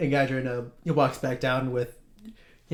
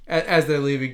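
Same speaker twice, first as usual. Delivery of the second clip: ordinary talk, nothing marked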